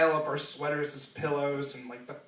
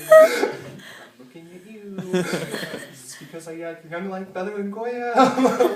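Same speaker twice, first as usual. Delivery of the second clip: laughing; 5 semitones higher, 9 dB louder than usual. Retold or imitated. Joking thought in the car.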